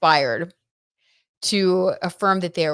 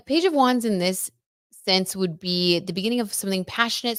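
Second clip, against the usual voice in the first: normal talking voice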